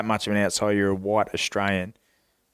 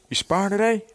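put-on a higher-pitched Mexican accent; Mexican trainer; 9 semitones higher than usual. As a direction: imitate the old Mexican trainer asking. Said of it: "this older Mexican dude's like".

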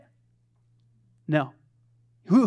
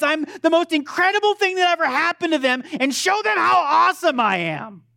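mock boastful wrestler; 8 semitones higher than usual. Preacher parodies a self-glorifying Jesus to the congregation, mock boastful. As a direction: hype yourself like a showboating pro wrestler. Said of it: "pro wrestler impersonation in the first service".

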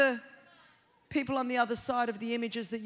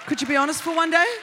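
louder, fast exasperated call; loud pleading God; 6 dB louder, faster than usual. Retold or imitated. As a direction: shout the challenge. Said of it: "and God goes".

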